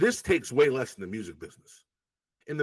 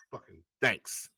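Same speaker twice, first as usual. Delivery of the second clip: sarcastic thanks; 7 semitones lower, 7 dB quieter, slower than usual. Retold or imitated. Sarcastic thanks to a late article.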